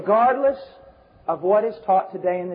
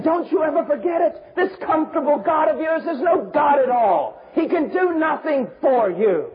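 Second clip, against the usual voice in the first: raised, high-pitched preaching; forceful preaching; 7 semitones higher than usual. Real, not acted. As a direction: preach it with full conviction.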